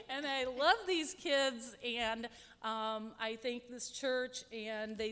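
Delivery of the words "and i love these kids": laughing. Real, not acted. Moved, laughing at close.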